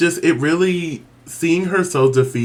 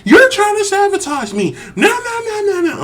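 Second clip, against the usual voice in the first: very high-pitched panicked wail; mock freak-out. Contestant imagined panicking about being sabotaged, mock freak-out. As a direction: play a hammed-up meltdown. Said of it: "freak out and be like".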